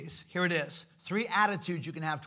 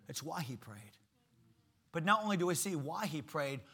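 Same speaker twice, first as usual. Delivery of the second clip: ordinary talk, nothing marked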